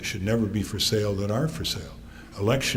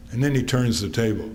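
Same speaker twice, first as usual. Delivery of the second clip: usual speaking voice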